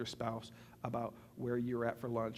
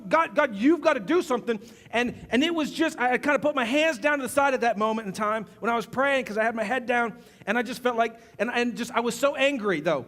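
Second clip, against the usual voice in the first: louder, higher, heated preaching; fiery sermon storytelling; 10 semitones higher, 13 dB louder than usual. Acted. Preacher relives an angry prayer at a concert, full force.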